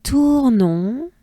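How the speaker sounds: Tournon stretched, pitch lifting at the end; drawn-out Tournon with nasal final -on, left hanging upward; French model pronunciation. Shown as a demonstration. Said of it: "let's say it this way".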